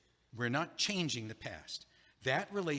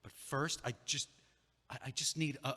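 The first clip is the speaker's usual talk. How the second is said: normal talking voice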